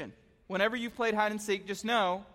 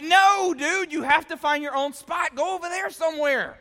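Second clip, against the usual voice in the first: higher, louder shooing; mock scolding shout; 7 semitones higher, 6 dB louder than usual. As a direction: send him away impatiently. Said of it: "And I'm like".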